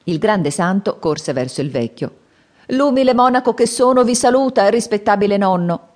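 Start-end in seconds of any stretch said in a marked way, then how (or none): 2.7-5.9 high, loud and courteous; bow and greet the old man sweetly; polite little monk. Acted. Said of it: "gli disse inchinandosi". (monacello cortese che saluta il vecchio, voce alta)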